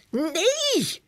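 put-on high, shrill shriek from a girl. Girl shrieks at her brother's hose threat. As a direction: squeal it out as the spray hits. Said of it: "gilt Janneke".

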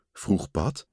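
usual speaking voice

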